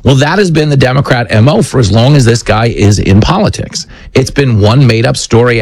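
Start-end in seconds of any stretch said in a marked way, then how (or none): none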